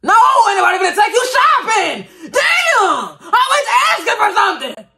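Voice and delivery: high laughing shout, hammed up; mock rant shout. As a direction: yell at her through laughter. Mock rant answering the girl.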